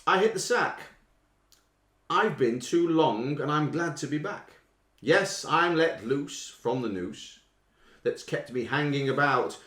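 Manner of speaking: slow, declaimed lyric reading; quiz lyric reading. Acted. Quizmaster reading song lyrics slowly to the listeners.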